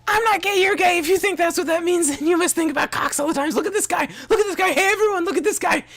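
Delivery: very high, very loud, voice cracking with rage; impression of angry friend. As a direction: scream it like a furious friend standing on his chair. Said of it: "my impression".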